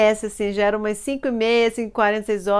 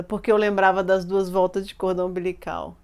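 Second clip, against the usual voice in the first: normal talking voice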